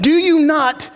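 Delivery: high, insistent rebuke; exasperated teacher, played up; acted exasperation. Acts out exasperated Jesus rebuking disciples.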